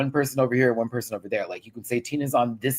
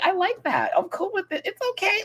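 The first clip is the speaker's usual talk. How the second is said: much higher, airy mock Lydia voice; Lydia's voice. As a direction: squeak it out like an eager young queen. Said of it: "Lydia's like".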